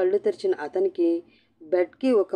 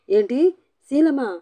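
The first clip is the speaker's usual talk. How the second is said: higher, louder disbelieving yell; shocked shout; 8 semitones higher, 6 dB louder than usual. A narrator's reading. Husband hears his wife's claim, shocked shout. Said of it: "గట్టిగా అరుస్తూ".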